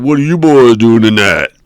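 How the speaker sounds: loud, stern questioning voice; suspicious cop. Retold or imitated. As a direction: lean in and interrogate. Cop leaning into the car.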